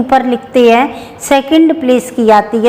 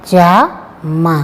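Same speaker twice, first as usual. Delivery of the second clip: जा मा said slowly with a gap between; spelling जा मा aloud; slow syllables; 4 semitones lower, much slower than usual. Shown as a demonstration. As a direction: sound out जा मा for the children. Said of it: "Jama किस प्रकार बनाएंगे".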